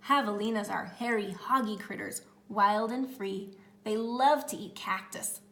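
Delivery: plain reading voice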